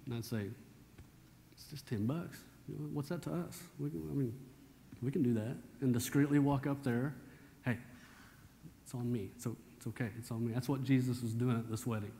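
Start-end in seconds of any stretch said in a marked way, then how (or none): 1.5-5.6 quietly calm her down; hushed reassurance. Retold or imitated. Husband quietly reassuring wife about ten bucks. 7.6-10.5 soft, low-volume offer; discreet offer. Retold or imitated. Man discreetly offering to pay for young couple.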